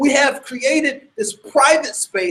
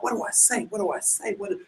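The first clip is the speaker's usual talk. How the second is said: anxious inner thought; 2 semitones lower, 11 dB quieter, faster than usual. Retold or imitated. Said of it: "And I'm thinking to myself".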